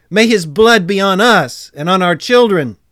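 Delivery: loud, high collective cry; crowd calling out. A narrator's reading. All the people answer Pilate, loudly calling out. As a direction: declare it forcefully for a whole crowd.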